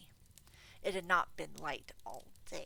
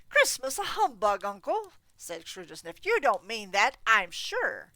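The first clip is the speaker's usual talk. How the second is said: loud, high, disbelieving; incredulous nephew; 5 semitones higher, 15 dB louder than usual. Acted. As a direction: protest in bright disbelief.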